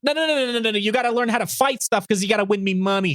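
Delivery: high-pitched bossy mom; rapid high refusals, then nagging; Chi-Chi. Retold or imitated. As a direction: overrule the kid in a mock-mother voice. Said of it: "Mom's like".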